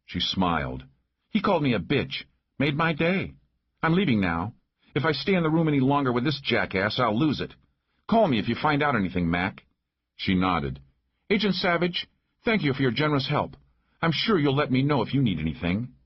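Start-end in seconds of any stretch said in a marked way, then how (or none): 1.3-9.6 higher female voice, mildly exasperated; lightly voiced irritated woman sheriff. Narrator voices Maggie fed up, leaving. 11.3-15.9 high, brisk female character voice; formally polite woman sheriff. Acted. Narrator voices Maggie's formal thanks.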